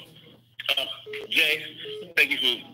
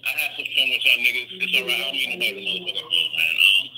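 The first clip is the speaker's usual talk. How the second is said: ordinary delivery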